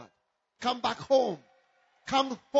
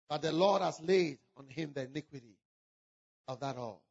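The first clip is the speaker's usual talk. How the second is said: hushed recitation; 9 semitones lower than usual. Acted. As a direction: drop to a low, solemn murmur. Low scripture recitation.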